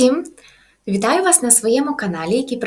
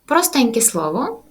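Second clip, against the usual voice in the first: ordinary delivery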